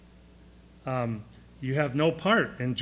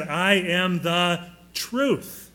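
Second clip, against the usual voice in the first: slow solemn quote; much slower than usual. Retold or imitated. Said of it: "because he said".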